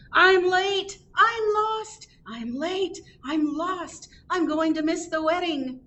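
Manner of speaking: very high opening cry, then easing slightly; yelling. A narrator's reading. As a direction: call out high, late and lost. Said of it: "Then a man came down the road yelling".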